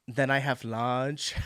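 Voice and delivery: slow, drawn-out imitation of an Italian accent; mock Italian accent. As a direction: copy the Italian guy's accent, slowly. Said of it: "I wanna do the accent so bad".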